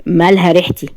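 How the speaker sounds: ordinary delivery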